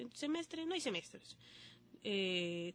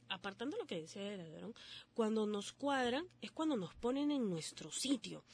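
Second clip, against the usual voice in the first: usual speaking voice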